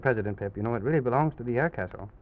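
ordinary delivery, nothing marked

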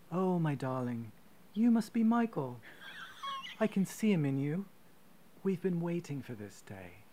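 posh British stepmother voice, gently doting; posh British accent. Retold or imitated. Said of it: "she's got a posh British accent".

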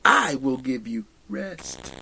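Jesus calming the weary, tender and quiet; gentle promise. Retold or imitated. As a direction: offer rest like a kind friend. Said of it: "and Jesus says".

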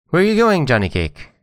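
very high, sharp call; sharp-voiced fox. A narrator's reading. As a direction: fox calling out sharply from where it lies. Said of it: "called out in a sharp voice".

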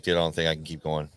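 low, quiet, quick admission; retold despair. Dream double confessing to himself, mildly despairing. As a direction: confess it low, half giving up.

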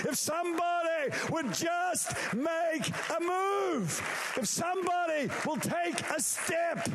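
high, building preacher's shout; impassioned shout. Acted. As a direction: thunder it as God demanding action. God's impassioned cry for action.

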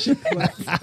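laughing. Real, not acted. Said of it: "Not she clucked".

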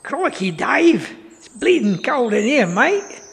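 slow, high Australian exclamation in a Steve Irwin-style bit; Australian accent. Retold or imitated. Wildlife presenter complaining to Dave about the cold, in a hammed-up Australian accent. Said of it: "an Australian or a South African".

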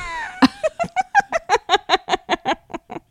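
high squealing burst of laughter; shrieking laugh. Real, not acted. Host cracking up at cohost's joke, high shrieking laugh. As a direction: let a sudden shriek of a laugh fly.

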